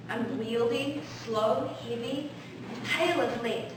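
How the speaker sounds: ordinary delivery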